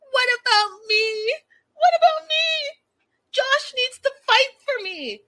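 hammed-up crybaby wail; mock whiny plea. Mocking the woman demanding Josh fight for her, whiny wail.

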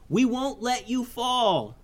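put-on high boyish voice calling upward; yelled up. Kaleo calling up to Kavi in the air: high urgent yell. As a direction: call out high to a friend hanging above. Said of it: "yelled Kaleo".

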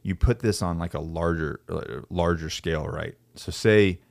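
normal talking voice

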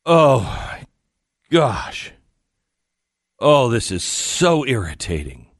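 imitated exasperated groaning complaint; irritated self-talk. Woman complaining to herself as the elevator stops, irritated. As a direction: grumble theatrically, drawing out the annoyance. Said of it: "she says to herself".

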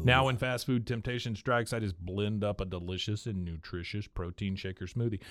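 scripted pitch with rising salesman lilt; sponsor ad read. Acted. Host pitching a portable blender to listeners, ad read. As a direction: sell it like a podcast ad.

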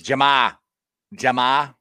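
loud, punchy Boston-accent name. Shown as a demonstration. Answering the host's request, loud Boston accent. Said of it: "give me your best Boston accent".

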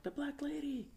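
high, soft mock wail; understated, high-pitched cry of fear; frightened little sister. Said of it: "her sister just started hysterically crying".

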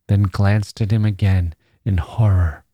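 plain reading voice

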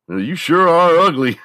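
high, loud mock insult; negative response. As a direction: toss out a video-game insult, high and loud. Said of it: "hit the negative response".

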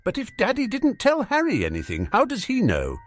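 put-on very high-pitched little boy; high, plaintive kid voice; whiny child. A narrator's reading. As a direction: pipe up with a childish question. Voices the boy Dudley piping up.

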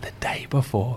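breathy whispered reveal; whispered aside; hushed whisper. Real, not acted. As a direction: lean in and whisper the secret. Secret reveal, hushed telling.

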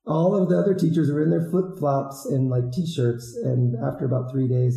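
ordinary talk

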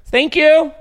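loud high scream. Real, not acted. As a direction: yell it out. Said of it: "why did I scream it into the microphone".

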